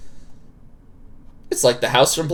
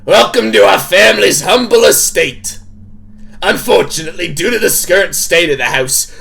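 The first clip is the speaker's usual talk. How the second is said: put-on game character voice, stern hostess; firm, projected character voice; Tabitha; 2 semitones higher, 3 dB louder than usual. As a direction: play a bossy hostess laying down house rules. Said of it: "I gotta do my Tabitha voice".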